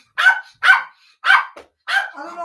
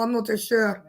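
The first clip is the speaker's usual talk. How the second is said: ordinary delivery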